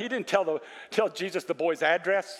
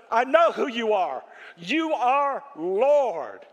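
raised, forceful proclamation, slowing right down; emphatic declaration; 4 semitones higher, 4 dB louder, much slower than usual. Acted. Preacher acting the official recognizing Jesus as Lord, emphatic declaration. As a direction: declare it with conviction, stretching the last words.

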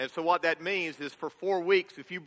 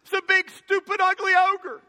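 much higher, louder, shouted fake panic; mock panicked villagers; 14 semitones higher, 7 dB louder, slower than usual. Shrek imitating people who scream at him. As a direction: flee the ogre in hammed-up terror. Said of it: "they go".

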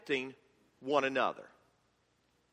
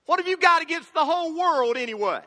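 much higher and louder, fed-up demand; exasperated Donkey; 11 semitones higher, 6 dB louder than usual. Retold or imitated. Pastor voicing Donkey arguing with Shrek. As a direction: throw the question at him. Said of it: "Donkey says".

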